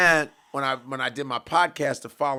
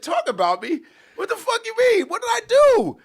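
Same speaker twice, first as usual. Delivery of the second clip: outraged what-the-hell reaction; shocked outrage; 8 dB louder than usual. Retold or imitated. Retelling his reaction to hearing T.I. was mad. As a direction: pitch leaps up in disbelief, loud.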